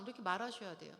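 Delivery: ordinary talk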